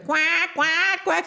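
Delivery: high, interrupting duck quacks; duck alarm. Retold or imitated. Alarm cuts into her dramatic speech, high imitated quacking. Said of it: "and then I hear".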